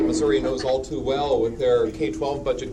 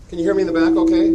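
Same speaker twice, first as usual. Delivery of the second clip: raised, higher and fast, projecting to the back row; calling to the back; 6 semitones higher, 5 dB louder, much faster than usual. Real, not acted. Speaker to audience at the back of the room, calling out loud. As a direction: throw your voice to the far seats quickly. Checking sound with back rows.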